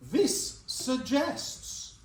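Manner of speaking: very slow, stretched sentence starter; slow teacherly prompt; drawn-out prompt. Shown as a demonstration. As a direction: draw it out to invite the class. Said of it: "carry that sentence on".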